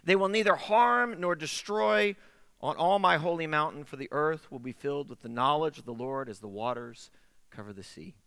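measured, solemn reading of scripture; solemn verse read aloud. Acted. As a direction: read it like a Bible passage, fading at the end. Scripture reading to open sermon.

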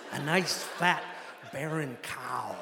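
Midwestern pilot, punched "fat", slow dropping "barren cow"; imitated Midwestern accent. Pilot repeats his hunting advice, emphatic Midwestern accent. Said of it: "exaggerated Midwestern accent".

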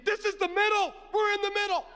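high, loud, awestruck cry at the tornado; awed excitement. Retold or imitated. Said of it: "I'm thinking".

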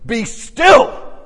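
loud, high command to the storm; commanding shout. Retold or imitated. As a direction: order the storm to stop. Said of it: "he stands up and goes".

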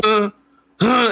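put-on high, puzzled little kid; a child who did not catch it; mock confused kid. Kid to teacher over video, mock confused. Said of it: "the kid's like".

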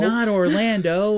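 hammed-up wail through laughter; dismayed father. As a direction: echo the mock lament, high, loud and slow. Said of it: "and the father's like".

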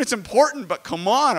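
ordinary talk

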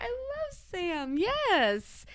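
high, drawn-out gush of affection. Real, not acted. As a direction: melt with love, high and slow. Gushing over the pick Sam.

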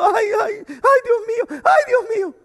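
high-pitched mock fretting; acted worrying. Preacher imitating believers lying awake over problems, mock worried wailing. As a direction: wail it like a sleepless worrier, hammed up. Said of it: "Porque estamos preocupados, estamos pensando".

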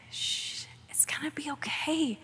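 put-on hushed whisper, calming a child; soothing whisper. Preacher voices God soothing a worried listener, whispered. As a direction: whisper like settling a scared kid.

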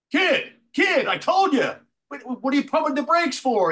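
high, loud exasperated yell; hammed-up angry director. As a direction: shout at the kid from below. Donner yelling at him.

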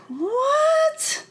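a very high, squealed what; shocked what. Retold or imitated. Hearing the huge income, a shocked high squeal. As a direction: shriek the word in pure disbelief. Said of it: "And I was like".

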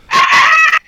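high, loud screech on the in-breath; inhaled raptor scream. Shown as a demonstration. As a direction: suck air in while screaming like a raptor. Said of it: "scream as you breathe in".

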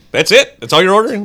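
mock disbelief. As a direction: imitate a put-out counter guy querying the order. Imitating an unimpressed order taker.

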